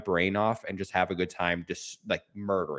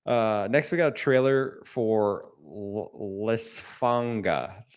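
reluctant trailer intro, dragging the title out slowly; unenthusiastic; slower than usual. Real, not acted. Host introducing a game trailer he dreads, unenthusiastic. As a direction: read the title slowly, with no excitement. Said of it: "You sound excited".

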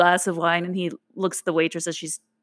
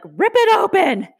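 urgent; 13 semitones higher, 5 dB louder, slower than usual. Retold or imitated. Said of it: "and I was like".

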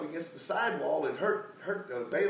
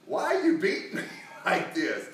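louder, higher mock complaint with a laugh; indignant protest; 6 semitones higher, 7 dB louder than usual. Donkey to the master who beat it, indignant protest.

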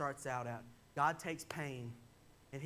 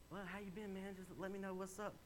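higher, softer casual small talk; casual greeting; 4 semitones higher, 7 dB quieter than usual. Retold or imitated. Friend opens breakfast talk at Waffle House, casual. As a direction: toss it out lightly, like catching up. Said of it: "you know, the casual".

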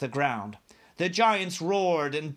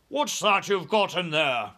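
demanding; 3 semitones higher, 4 dB louder than usual. A narrator's reading. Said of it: "demanded to know".

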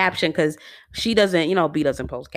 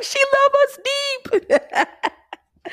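very high, slow, laughing squeal; laughing mock quote; much slower than usual. Fan joking about [name]'s sign-off, high and laughing. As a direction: stretch it out high through a laugh.

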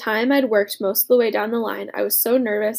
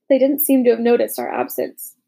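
ordinary delivery

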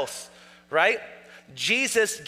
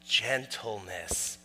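Gentleness drawn out slowly, lower and much softer; slow soft repeat; 9 semitones lower, 9 dB quieter, much slower than usual. Shown as a demonstration. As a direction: savor the word Gentleness, slow and hushed.

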